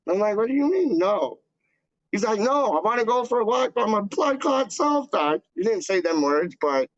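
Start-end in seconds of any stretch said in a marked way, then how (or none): 0.4-1.4 high-pitched mock disbelief; playfully affronted retelling; retold indignant protest. Retold protest at dad's refusal. 2.4-5.4 high-pitched mock exasperation; retold adamant refusal; imitated insistent dad. Imitating dad insisting on walking alone.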